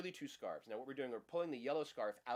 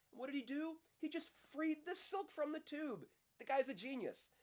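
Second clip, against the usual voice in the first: delighted admiration. Real, not acted. Teacher marvels at the trick.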